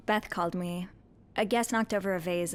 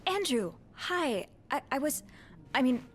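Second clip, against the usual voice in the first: startled and flustered; 5 semitones higher than usual. Acted. Maid caught eavesdropping greets Andrew, startled and flustered. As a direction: stammer like you got caught.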